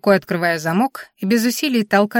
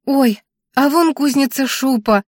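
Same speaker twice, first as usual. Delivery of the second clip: higher, drawn-out happy exclamation; exclaiming; 6 semitones higher than usual. A narrator's reading. Granddaughter spotting the smithy from the window, exclaiming. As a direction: call out a sudden discovery. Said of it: "воскликнула я".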